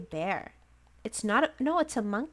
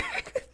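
high giggle; 5 dB quieter than usual. Real, not acted. Axe murderer joke.

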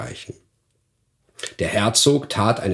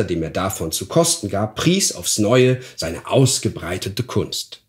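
ordinary delivery

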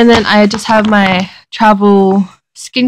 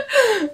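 high giggle; 13 semitones higher, 6 dB quieter than usual. Real, not acted. Laughing with a friend in the car.

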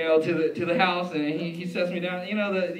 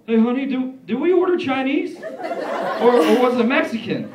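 loud, high confused question; imitated dad, baffled and loud; puzzled dad; 7 semitones higher, 10 dB louder than usual. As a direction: call to your wife in mock bewilderment. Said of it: "he's like".

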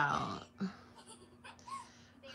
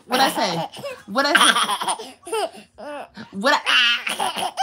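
loud, mock-stern challenge; mock telling-off; 5 semitones higher, 11 dB louder, slower than usual. Mama to baby who keeps kicking, mock scolding. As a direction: play at scolding, getting louder.

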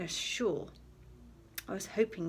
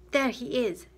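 loud excited shout; 4 semitones higher, 8 dB louder than usual. A narrator's reading. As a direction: call it out loud across the water. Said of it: "Jack shouted".

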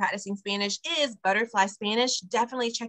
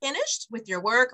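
ordinary talk

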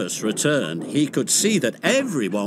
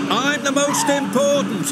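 snapping; 12 semitones higher than usual. A narrator's reading. Max snapping at Monty in the rivalry. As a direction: bite the words off angrily. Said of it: "snapped Max".

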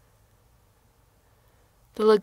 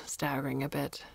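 ordinary delivery

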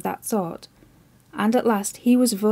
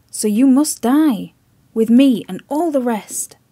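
grim warning; 6 dB louder than usual. Acted. Chips foretelling the admiral's death, loud and grim.

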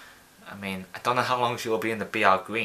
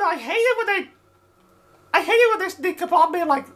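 high, loud frustrated whine; exasperated complaint; 5 dB louder than usual. Real, not acted. Player griping at enemies sneaking up, exasperated. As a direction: vent the annoyance at being ambushed. Complaining after being ambushed.

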